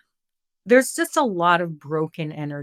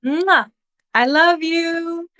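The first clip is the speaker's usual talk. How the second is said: affectionate kiss; 11 semitones higher, 6 dB louder, slower than usual. Real, not acted. Blowing a kiss to friend.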